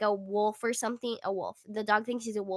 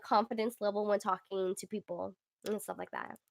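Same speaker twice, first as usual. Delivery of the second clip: ordinary talk, nothing marked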